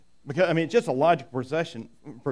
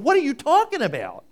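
higher, louder confused outcry; baffled question; 9 semitones higher, 6 dB louder than usual. Retold or imitated. Disciples asking Jesus, higher-pitched and baffled. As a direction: throw it back in bafflement. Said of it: "we look back at Jesus going".

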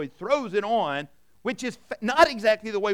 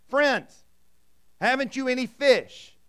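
calling out; 6 semitones higher, 4 dB louder, slower than usual. Acted. Jesus calls out from shore.

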